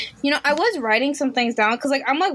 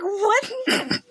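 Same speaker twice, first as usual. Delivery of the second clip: stunned what; 9 semitones higher than usual. Retold or imitated. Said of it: "And I'm like".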